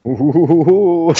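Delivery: hammy spooky ooh and cackle; badly faked villain laugh; bad evil laugh. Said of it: "That was the worst laugh ever".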